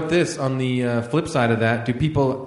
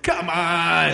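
hammed-up exasperated heckle; heckling voice; 3 semitones higher than usual. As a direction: heckle the audiobook from the background. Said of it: "there's other voices going like".

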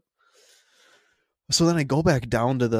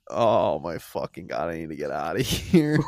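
mock horror; 6 semitones lower than usual. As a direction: drag it out, stunned and appalled. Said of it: "I looked at this, I'm like".

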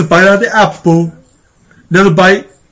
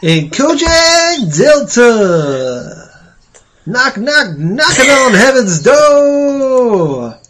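slow, drawn-out, higher Dylan drawl; [name] voice; 6 semitones higher, much slower than usual. Pretend. Host reading the show's shout-outs as [name] on request. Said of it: "do your shout-outs as [name]".

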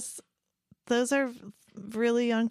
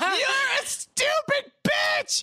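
high, drawn-out acted scream; hammed-up furious shriek; angry yell; slower than usual. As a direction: scream it at a hated coworker, full voice. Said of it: "wanted to yell at someone I work with".

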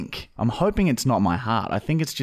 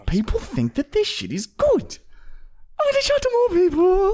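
squeaky cartoon voice doing YouTube; YouTube algorithm voice. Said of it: "'Cause then YouTube's like".

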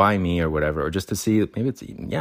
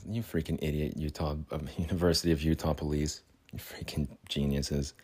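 muttered insult; 3 semitones lower, 8 dB quieter than usual. Real, not acted. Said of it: "muttered insult".

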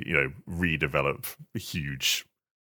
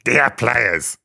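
high, strained creature croak; Gollum voice; 7 semitones higher, 9 dB louder than usual. Pretend. Co-host asked to read apology as Gollum, high croak. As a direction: attempt a Gollum rasp, then give up. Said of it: "That's terrible".

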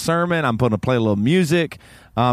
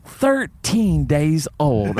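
each word drawn out at an ordinary pitch; deliberate, stretched-out delivery; retold slow emphasis; much slower than usual. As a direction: quote it very slowly, word by word. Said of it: "She said".

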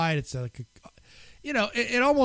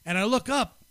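ordinary talk, nothing marked

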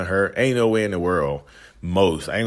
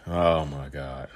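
exasperated; 4 semitones lower, much slower than usual. Real, not acted. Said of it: "I'm just like".

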